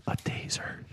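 hushed secret whisper. Pretend. Secret reveal of pocket contents.

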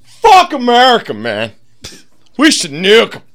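loud, high rowdy rallying cry; drunk pub patriot. Retold or imitated. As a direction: whip up the pub crowd. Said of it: "somebody stands up and is like".

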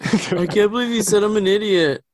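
put-on high, loud, whiny little kid. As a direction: play an outraged six-year-old. Kid voiced, outraged.

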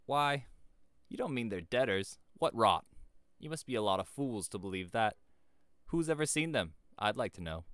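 loud, high schoolboy scorn; scornful and bitter. A narrator's reading. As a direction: pour contempt on the whole idea. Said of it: "Stanley was scornful and bitter".

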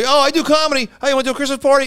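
high, bright mock-chipper voice of a party booker; mock eager booker. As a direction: ham up the eager caller offering a gig.

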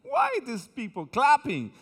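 slow, drawn-out puzzled English; imitating a confused Anglo brother; mock puzzled gringo. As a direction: lightly play a baffled Anglo churchgoer. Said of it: "los hermanos anglos dicen".

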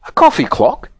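a touch of puzzled surprise; surprised. A narrator's reading. Saucepan man mishears toffee shock, surprised. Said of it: "even more surprised".

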